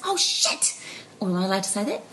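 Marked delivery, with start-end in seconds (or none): 0.0-0.7 put-on high child's oh shit, much higher; cheeky child. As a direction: blurt it like a four-year-old. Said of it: "and he goes".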